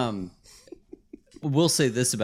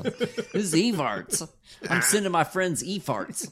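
mock app voice; 7 semitones higher, slower than usual. Comic bit voicing Evarts app.

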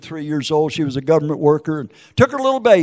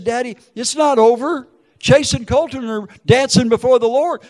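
raised, louder, insistent voice; daughter's urgent consolation; 7 semitones higher, 5 dB louder than usual. Retold or imitated. Daughter telling Daddy it's not over, urgent reassurance. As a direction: lift it high and strong to comfort Daddy.

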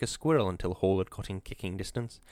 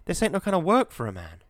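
much higher and louder complaint; a worker near breaking point, shouting; despairing outburst; 8 semitones higher, 4 dB louder than usual. A narrator's reading. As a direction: howl it up at the ceiling in despair. Wisconski despairing about the job.

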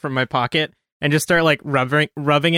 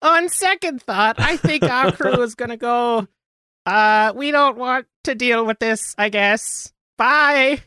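high-pitched coin voice; squeaky little voice; 10 semitones higher than usual. Pretend. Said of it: "it just sounds like me being like".

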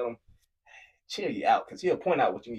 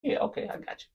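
mumbled reply. Retold or imitated.

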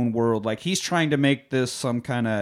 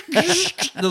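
robot exit noise. Retold or imitated. Imitating robot exit sound.

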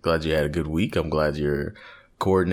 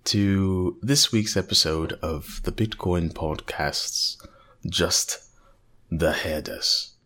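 put-on mild British wildlife documentary narrator accent. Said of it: "I can't do that accent very much longer".

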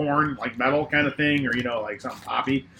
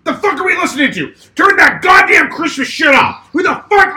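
furious shout, much higher and louder; boss yelling; 12 semitones higher, 7 dB louder than usual. Retold or imitated. Coworker imitates boss raging at the Christmas music, furious shout. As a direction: blow up like the irate boss. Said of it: "and he goes".

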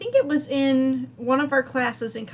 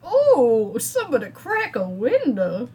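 swooning Southern belle with stretched vowels; overdone Southern lady, wilting; hammed-up Southern accent; 2 semitones higher, slower than usual. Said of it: "like Claire Bell Cow Southern accent".

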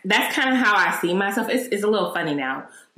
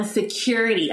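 ordinary delivery

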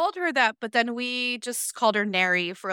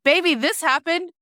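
higher, louder exasperated outburst; exasperated quote; 6 semitones higher, 5 dB louder than usual. Retold or imitated. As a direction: blurt it out in disbelief. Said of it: "I'm like".

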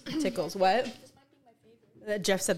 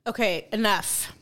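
ordinary talk

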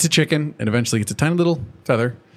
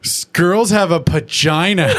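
slower, higher, louder, laughing; child correcting a parent; imitating son; 5 semitones higher, 4 dB louder than usual. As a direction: son explaining to mom. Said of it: "he goes".

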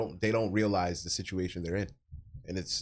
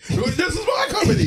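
high, loud screaming villain; Green Goblin freakout; 10 dB louder than usual. Retold or imitated. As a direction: shriek it out like a madman. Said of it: "He started freaking out".

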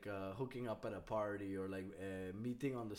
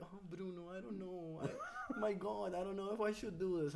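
mock innocent girl; 7 semitones higher than usual.

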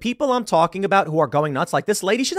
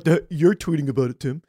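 mock dumb voice. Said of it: "you get people being like".

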